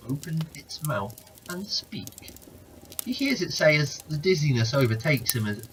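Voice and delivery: usual speaking voice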